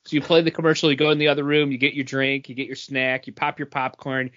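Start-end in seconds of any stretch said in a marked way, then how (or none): none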